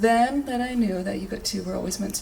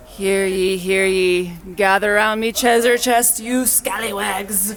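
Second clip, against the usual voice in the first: loud booming pirate call; 9 dB louder than usual. Acted. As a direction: bellow it like a ship's captain. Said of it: "I'm not gonna talk in pirate voice".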